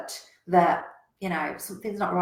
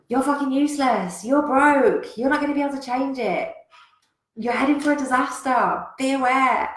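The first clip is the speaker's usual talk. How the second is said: harsh, higher, louder devil; 6 semitones higher, 6 dB louder than usual. Retold or imitated. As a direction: snarl like a nasty devil. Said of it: "The devil's going".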